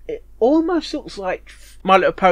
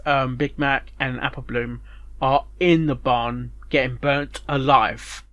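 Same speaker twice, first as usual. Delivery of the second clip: usual speaking voice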